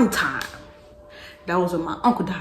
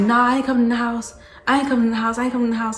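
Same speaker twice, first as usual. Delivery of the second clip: stubborn refusal; 5 semitones higher, 6 dB louder, much faster than usual. Retold or imitated. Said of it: "I was already like".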